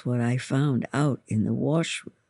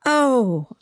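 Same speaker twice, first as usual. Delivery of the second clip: high, louder cry of pain, restrained; narrated yelp, pitched up; screamed; 10 semitones higher, 5 dB louder than usual. A narrator's reading. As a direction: cry out as if scalded, short of a full scream. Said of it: "I screamed".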